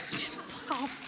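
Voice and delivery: slow, voiceless whisper of Just fuck off; a slow hushed inner swear; slow whispered retort. Retold or imitated. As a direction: breathe it out slowly as a secret curse. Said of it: "And I think".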